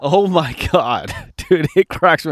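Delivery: ordinary delivery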